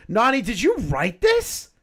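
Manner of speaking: high, slow, teasing accusation; mock accusing. Real, not acted. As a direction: needle her playfully. Teasing Nani about document.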